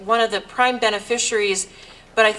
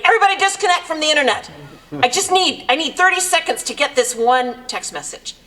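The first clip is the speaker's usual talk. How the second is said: high, quick, exasperated call to the room; retold yell; 6 semitones higher, 3 dB louder than usual. Speaker retelling Dennis's plea to his household, yelling out. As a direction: imitate him yelling out to everybody. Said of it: "he yells out to everybody".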